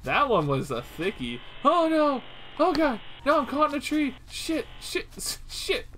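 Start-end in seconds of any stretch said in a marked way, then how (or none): 1.6-5.9 high-pitched flustered panic; panicked. Real, not acted. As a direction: cry out as the monster closes in. Caught in tree, Slender Man near.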